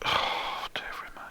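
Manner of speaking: hushed, pitying whisper; mock dismay. Listener's pitying reaction to the sad song, whispered. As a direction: sigh it out under your breath. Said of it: "And you're like".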